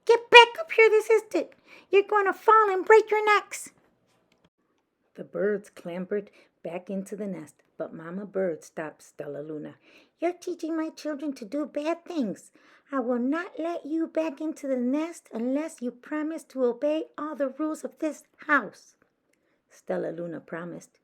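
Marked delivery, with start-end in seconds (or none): 0.1-3.7 high, loud furious mother bird; scolding shout. Acted. Mother bird scolds dangling babies. 10.2-18.9 higher, firm voice for the mother bird; stern mother bird. Acted. Mother bird lays down rules.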